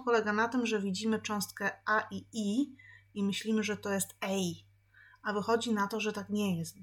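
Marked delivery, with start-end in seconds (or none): none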